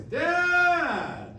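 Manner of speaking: high, loud mock whine of Dad; sing-song complaining child voice; whiny kid calling Dad. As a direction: mimic a teenager's exasperated Dad. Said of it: "it's always".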